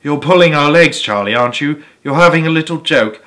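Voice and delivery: ordinary delivery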